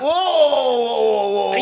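long, loud cry of alarm; mock panic. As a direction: wail in hammed-up fright. Acting a frightened hold-up victim.

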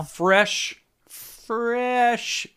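slow high stretched words; drawn out. Pretend. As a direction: stretch fresh icicle out slowly. Drawn out word.

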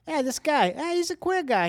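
high-pitched Chicago accent; mock Chicago accent. Shown as a demonstration. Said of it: "like Chicago".

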